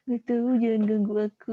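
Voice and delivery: a limp, whiny "Nggak tahu, jangan ganggu aku"; sad weak voice. Shown as a demonstration. Teacher acts a sad child telling others to go away. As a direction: sound tired and sad, wanting to be left alone. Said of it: "Ngomongnya digituin".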